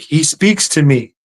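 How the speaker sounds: rising, ecstatic voice of a convinced believer; believer's rapture. Retold or imitated. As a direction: gush like she's been touched by God. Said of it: "she's like".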